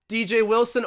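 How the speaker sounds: high, loud shout of D J Wilson; excited shout of the name. Acted. Streamer hyping his favorite player to chat, loud excited call. Name called then repeated.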